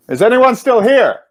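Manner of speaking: high, loud call into the dark; frightened yell into empty halls. A narrator's reading. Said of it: "I yelled out".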